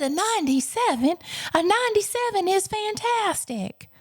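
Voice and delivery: high-pitched, incredulous exclamation; amused teacher impression. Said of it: "In her big bellowing laugh, she chuckled".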